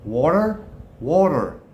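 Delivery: put-on American WATER with a strong final R; American accent. Shown as a demonstration. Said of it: "with an American accent".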